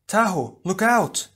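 loud, high urgent cry; a friend shouting a warning mid-fight. Acted. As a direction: yell to save him.

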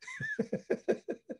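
high, soft run of laughter; soft high giggling; real laugh. Real, not acted. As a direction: laugh at your own dark joke. Laughs at own dark joke.

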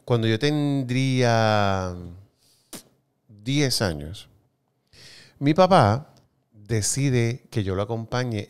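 slow, drawn-out storytelling pace; slow buildup. Real, not acted. Building up the story of his father's flight, slow. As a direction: drag it out to build suspense.

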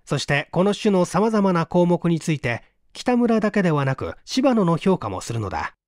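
ordinary delivery, nothing marked

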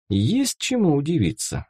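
ordinary delivery, nothing marked

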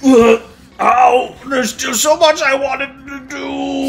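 mock dying. Mock dying game character.

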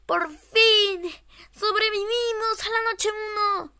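high, loud cheer of relief; triumphant shout. Real, not acted. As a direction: yell it in victory. Surviving the night.

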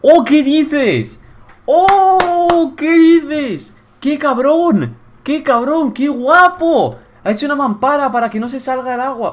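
loud, high, stretched-out squeals of praise; ecstatic amazement. Real, not acted. Player thrilled by the shower screen, high loud excitement. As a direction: shriek it in disbelieving delight.

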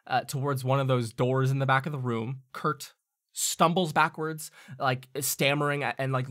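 ordinary talk, nothing marked

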